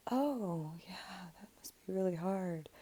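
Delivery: mock dismissive whisper. Dismissive friend brushing off pain.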